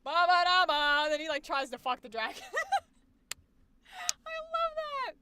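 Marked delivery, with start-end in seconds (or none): 0.0-1.0 loud "ba ba da ba" fanfare; Scanlan. Retold or imitated. Streamer imitating Scanlan pushed out at the dragon, loud blurting. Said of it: "and he goes". 4.2-5.1 very high, giggly gush; squealing delight. Real, not acted. Streamer delighted by own Scanlan bit, high squeal.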